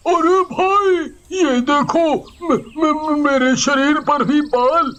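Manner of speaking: high, stammering panicked villager; घबराया हुआ. Acted. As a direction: stutter out the shock, voice up high. Villager finds hair on body. (ऊँची आवाज़ में हकलाता, घबराया गाँव वाला)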